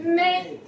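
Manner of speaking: "may" held high and level; high first tone. Shown as a demonstration. Teacher illustrates first tone with English, high level "may". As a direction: sustain "may" on one flat note. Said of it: "That's first tone".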